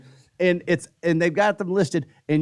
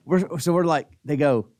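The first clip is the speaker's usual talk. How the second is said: normal talking voice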